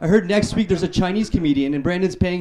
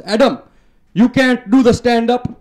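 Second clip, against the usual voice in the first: higher, louder, slower scolding grandpa voice; grandpa's voice; 7 semitones higher, 7 dB louder, slower than usual. Retold or imitated. Grandpa telling Adam to quit comedy, high scolding old-man voice. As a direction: wag a finger like a disapproving old grandpa. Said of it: "he goes".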